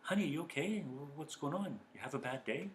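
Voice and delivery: soft, gentle concern; relaxed tender check-in; calm caring. Retold or imitated. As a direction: ask gently, truly caring. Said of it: "your posture's changed".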